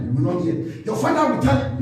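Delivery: ordinary talk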